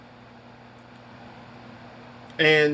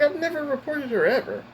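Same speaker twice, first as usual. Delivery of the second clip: high-pitched protest, retold; indignant denial; 10 semitones higher than usual. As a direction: deny it emphatically, pitch held high.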